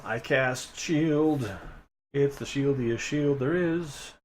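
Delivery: drawn-out mock-heroic boast; hammy slow wizard pride; slow grand declaration. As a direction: savor each word of the spell.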